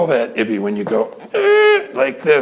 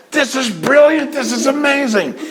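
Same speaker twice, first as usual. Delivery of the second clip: raised, high excited praise; imitating delight; 11 semitones higher than usual. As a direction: gush like a thrilled audience. Audience's imagined delighted reaction.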